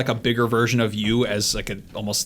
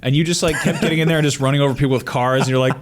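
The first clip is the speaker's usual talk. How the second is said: usual speaking voice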